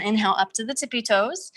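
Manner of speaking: normal talking voice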